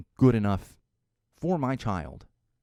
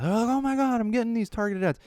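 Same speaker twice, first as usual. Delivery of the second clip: mock freak-out; 12 semitones higher than usual. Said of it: "people freak out".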